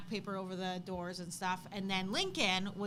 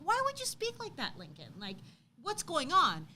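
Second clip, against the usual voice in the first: scolding disbelief; 6 semitones higher than usual. Retold or imitated. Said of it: "And I'm like".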